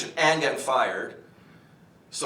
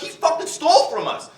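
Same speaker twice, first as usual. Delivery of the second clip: indignant yell; 6 dB louder than usual. Retold or imitated. Heated retold quarrel.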